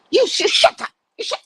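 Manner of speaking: loud, high, stuttering outburst; hammed-up angry imitation; mock furious retort. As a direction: splutter it like an irate woman. Acted bus quarrel, woman losing temper.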